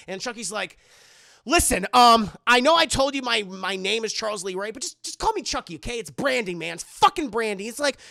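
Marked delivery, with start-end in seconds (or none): 1.4-7.7 loud, exasperated acted rant; irritated yelling. Chucky insisting on his nickname to Tyler, irritated yelling. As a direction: snap loudly at a little kid. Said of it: "You don't need to yell and cuss at me".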